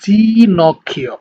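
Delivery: জি নক্ষীয় with জি as a clear ee sound, slow; Italian pronunciation. Shown as a demonstration. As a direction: say জি নক্ষীয় slowly, the জি like "jee". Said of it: "ই একটা sound আপনার মুখ থেকে বের হচ্ছে".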